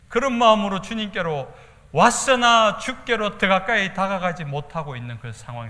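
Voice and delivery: loud, high fervent preaching. Real, not acted. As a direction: drive the point home with force. (힘차게 외치는 설교)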